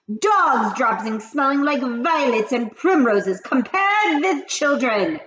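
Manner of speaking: high, loud witch rant. Acted. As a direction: rage like the Grand High Witch. Grand High Witch speech.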